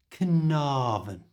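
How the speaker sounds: Carnarvon with a long, stressed na, slowly; Carnarvon, lean on the na and hold it; slow Carnarvon, na stretched and stressed; long stressed middle. Shown as a demonstration. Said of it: "Make the na sound in the middle quite long and stressed".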